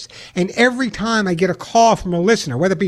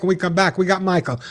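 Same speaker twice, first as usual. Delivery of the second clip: usual speaking voice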